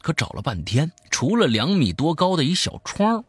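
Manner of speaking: lively storyteller swinging between hushed and emphatic; spoken horror-story narration; dramatic storytelling. A narrator's reading. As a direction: tell a creepy tale with punched key words. Tells a horror story aloud.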